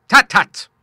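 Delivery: loud, high gruff "tut, tut"; the old seaman scoffs loudly at his son's worry; hearty cry. A narrator's reading. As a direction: brush it off with a booming cry. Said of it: "cried the Admiral".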